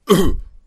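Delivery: loud, hollow throat-clearing cough; old man's cough. Pretend. The old grouch Jochem coughing from the back of the house, loud hollow cough. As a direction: bark it out like a grumpy old man.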